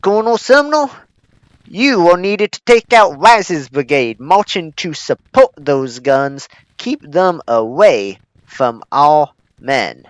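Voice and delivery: loud Southern commander; commanding Southern bellow; southern accent. Pretend. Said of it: "I shouldn't have a southern accent when doing that".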